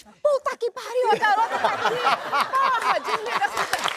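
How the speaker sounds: high, slow shocked outburst with a laugh; startled swearing. Retold or imitated. Policemen realise the forgotten girl is in the back, high startled swearing. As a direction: blurt it out caught completely off guard. Cops startled she was there.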